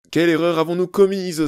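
high, loud mock sob; hammed-up sobbing wail; sobbing mother. A narrator's reading. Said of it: "sanglota madame Elliot".

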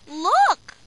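loud, high cry of alarm. A narrator's reading. Lowly alerting Huckle to a claw shadow, crying out. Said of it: "Lowly cries".

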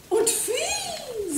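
bright little fly squeak; high fly voice. A narrator's reading. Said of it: "summte die Fliege".

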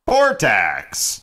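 grand mock-epic announcer calling the roster; booming announcer. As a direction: boom out the names like a ring announcer. Announcer names the artist.

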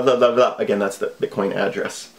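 ordinary talk

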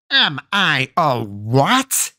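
slow, menacing crone question; indignant witch. A narrator's reading. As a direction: drag it out, daring him to repeat it.

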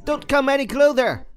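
high-pitched frightened warning. Acted. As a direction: warn them off in a panic. Backing away from the snake.

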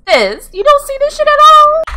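very high, loud squeal; exasperated disbelief. Real, not acted. Player to sis who sees nothing, incredulous squeal. Presses the oblivious Sim.

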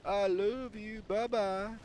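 very high, slow silly voice; sing-song it up in a squeaky falsetto; drawn-out, high goofy character voice; playful falsetto. Acted. Playful falsetto character bit mid-game.

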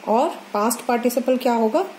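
ordinary talk

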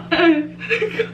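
giggling while lips are pulled wide; laughing through stretched lips. Real, not acted.

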